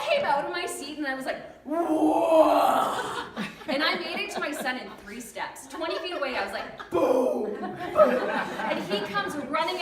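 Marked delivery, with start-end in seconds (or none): 1.6-3.2 loud, long mock-possessed "whoa"; possessed roar. 6.9-8.3 loud imitated impact noises, second one higher; explosive booms. Acting out leaps across playground.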